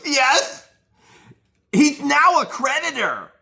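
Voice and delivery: high, loud, slow mocking imitation; mock eager squeal. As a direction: squeal it like an overeager witness. Mocking her eager answer.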